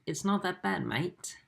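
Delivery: a touch of mock-Aussie protest; Australian accent. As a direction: lightly ham up an Australian accent. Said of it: "Lol, my Australian accent".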